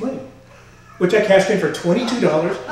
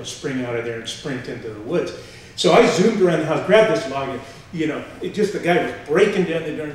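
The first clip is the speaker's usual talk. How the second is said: ordinary talk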